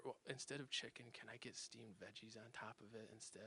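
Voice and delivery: retold whisper. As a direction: whisper so dad won't hear. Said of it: "I whispered to the waiter".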